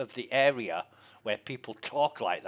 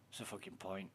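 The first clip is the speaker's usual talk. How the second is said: whisper; 4 semitones lower, 16 dB quieter than usual. Real, not acted. Whispered aside.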